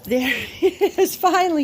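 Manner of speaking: high, laughing hello to the dog; excited greeting. Real, not acted. Trainer greeting the dog as he arrives, laughing praise.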